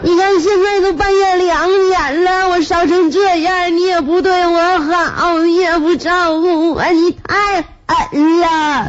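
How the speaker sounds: high, slow, hammed-up wailing; crying while talking. Feverish wife complaining to husband at night, slow mock wail. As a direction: drag each complaint out in mock sobs. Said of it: "我一边哭就一边说".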